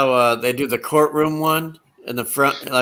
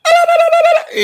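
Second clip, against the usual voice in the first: very high, loud rattled-off babble; shrieked nonsense syllables; Curly's babble; 7 dB louder, much faster than usual. Retold or imitated. Curly seeing Moe in feathers, imitated in a loud high babble. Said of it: "he's like".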